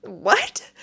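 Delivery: rising, disbelieving question; can't believe she cut in; retold shock at the woman cutting in; incredulous. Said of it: "I was like".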